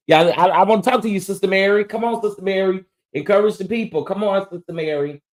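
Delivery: high, fast, fired-up call to Sister Mary; excited calling. Acted. Streamer fired up, urging Sister Mary to come encourage people, high and fast. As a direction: hurry her in with high eager pleading.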